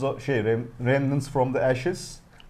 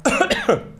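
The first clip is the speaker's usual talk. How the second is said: sudden coughing fit from coffee; coffee going down the wrong way, coughing; choking on a drink; 3 dB louder than usual. Real, not acted. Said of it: "Acaba boğazıma kaçtı". (içecek boğaza kaçmış gibi öksürük)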